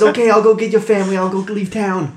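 quick, loud hurried guard. Acted. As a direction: rush to agree.

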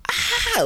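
sudden high yelp of pain; retold scream of pain. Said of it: "I was like".

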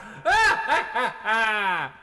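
high, cackling villain laugh; maniacal laugh. Pretend. Playful villain laughing exchange.

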